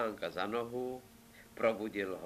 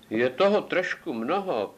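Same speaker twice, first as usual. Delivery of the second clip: Ivánek objecting; 5 semitones higher, 8 dB louder than usual. Acted. Ivánek answers the royal demands.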